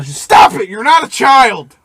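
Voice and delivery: angry bar owner yell; shouting. Acted. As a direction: yell it at a misbehaving patron. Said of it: "bar owner yell".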